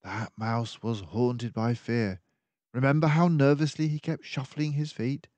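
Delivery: a sharp-minded sleuth laying out the danger; keen detective warning of the villains; mouse detective. Acted. Detective recalls the messenger.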